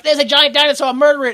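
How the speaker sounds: high, loud mock panic; rally the mob, hammed up; mock mob yell. Acting out the mob's reaction.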